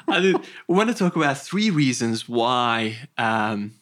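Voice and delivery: restarting through a giggle; laughing. Real, not acted. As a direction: still laughing at the joke. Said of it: "I can't stop thinking about the bee now".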